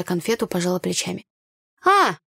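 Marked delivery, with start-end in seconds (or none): 1.8-2.2 high, louder sudden exclamation; light up as it comes back to you; sudden brightening. A narrator's reading. Tanya suddenly remembers village gossip, brightening. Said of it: "внезапно просияла она".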